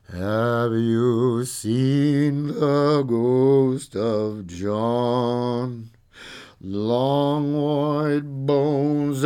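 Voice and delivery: slow, spooky ghost-story drawl; hammed-up spooky voice, stretching every word; trying to be scary. DJ spooking radio listeners with a ghost song, mock scary. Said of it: "I'm just trying to be scary".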